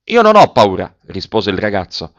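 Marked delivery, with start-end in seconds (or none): 0.1-0.9 high, loud answer; calling back. A narrator's reading. Boy defying the officer's order, calling out. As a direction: call the answer down from a height.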